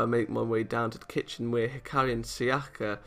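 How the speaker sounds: usual speaking voice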